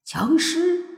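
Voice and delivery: high, startled echo; stunned question. A narrator's reading. Night Wanderer taken aback by Mo Fan's revelation, stunned. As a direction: repeat it in sudden high-pitched surprise. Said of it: "夜游神一愣".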